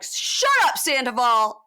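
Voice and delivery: high, loud imitation of an exasperated shout; mock group yell. Retold group shouting him down.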